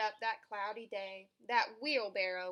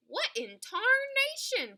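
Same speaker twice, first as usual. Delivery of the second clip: high, loud, drawn-out startled cry; Pa yelling as the field catches fire; screaming; 12 semitones higher, 6 dB louder, slower than usual. A narrator's reading. Pa shocked at the burning cornfield, screaming high and loud. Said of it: "Pa screamed".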